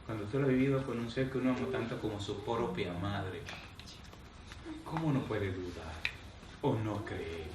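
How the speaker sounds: quiet reflective preaching. Acted. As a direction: let it sink in, hushed and earnest. Quiet reflective preaching, long pauses. (predicación íntima, en voz baja y pausada)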